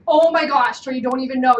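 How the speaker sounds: loud, breathless excitement; excited retelling. Retold or imitated. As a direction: burst out with the news. Retold excited telling to husband.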